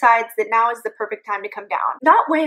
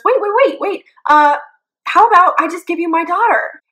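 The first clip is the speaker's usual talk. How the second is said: high, loud mock-panicked plea; frantic bargaining; 7 semitones higher, 6 dB louder than usual. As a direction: blurt a hammed-up desperate offer to save yourself. Dad frantically bargains with monster.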